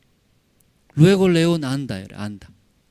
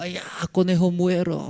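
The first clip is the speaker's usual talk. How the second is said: quejido agudo de conejo moribundo, sin gritar de verdad; grito del conejo imitado; 6 semitones higher than usual. Retold or imitated. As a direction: imita un chillido de agonía, alto y contenido. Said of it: "el conejo gritaba". (grito fingido de conejo aterrado)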